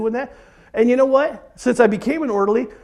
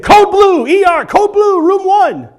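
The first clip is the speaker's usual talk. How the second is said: calling the code, loud and higher; urgent call out; 8 semitones higher, 6 dB louder, slower than usual. Retold or imitated. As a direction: announce the emergency loud. Hospital code called out.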